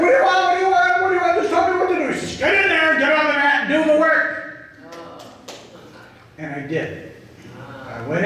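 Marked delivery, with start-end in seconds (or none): 0.0-2.1 much higher, louder, frantic; desperate pleading. Retold or imitated. [name] cornered by Thane, desperate high pleading. 2.4-4.7 much higher, louder yell; commanding shout. Retold or imitated. Thane ordering [name] onto the mat, commanding shout.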